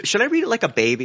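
usual speaking voice

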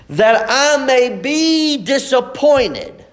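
ordinary talk, nothing marked

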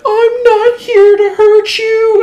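high, slow, tearful stranger's voice, far above own pitch; crying woman. Retold or imitated. Strange woman at the door pleading through tears, high and slow. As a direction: imitate a sobbing older woman pleading. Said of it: "she just kept saying that".

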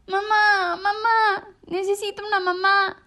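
a lost chick crying for mama; crying little bird. A narrator's reading. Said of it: "empezó a llorar".

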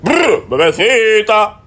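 high, slow, drawn-out [name]-style ad-lib. Retold or imitated. Friend mocks [name] for the hosts with a slow high ad-lib. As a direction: stretch it out like [name]'s signature ad-lib. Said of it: "Versus [name]".

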